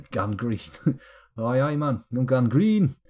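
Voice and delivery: bad Geordie accent, laughing. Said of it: "I can't really do a Geordie accent".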